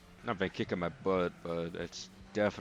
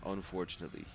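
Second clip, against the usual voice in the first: quiet deadpan; 7 dB quieter, faster than usual. Real, not acted. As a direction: mutter it to yourself, flat. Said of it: "So unfortunately".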